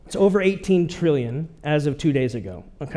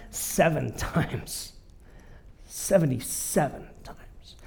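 hushed emphasis; 3 semitones lower, 8 dB quieter, slower than usual. Real, not acted. Preacher drops to hushed emphasis.

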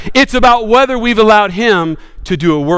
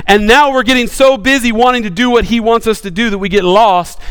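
ordinary talk, nothing marked

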